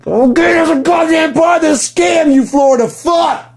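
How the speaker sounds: real angry high shout; furious yell. Real, not acted. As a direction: scream the insult out. Yelling insult.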